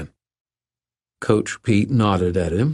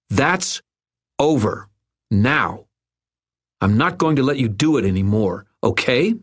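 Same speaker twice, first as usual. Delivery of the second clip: raised pitch, firm separate phrases; snarling confrontation; 5 semitones higher than usual. A narrator's reading. Bullied boy confronting his tormentor, firm snarl. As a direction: stand up to a bully, pitched high and emphatic. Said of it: "Irwin snarled".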